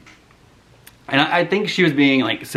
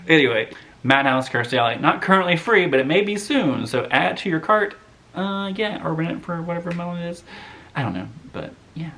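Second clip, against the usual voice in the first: usual speaking voice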